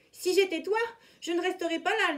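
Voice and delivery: mild urgent panther cry; panther calling out. A narrator's reading. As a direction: call out a warning. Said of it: "lui cria la panthère".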